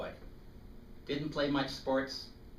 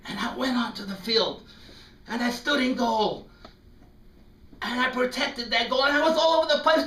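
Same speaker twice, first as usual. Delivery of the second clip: much higher, louder thrilled rush; excited; 14 semitones higher, 9 dB louder than usual. Retold or imitated. Boy-goalkeeper thrilled at his saves, high excited voice. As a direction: relive the glory of every save.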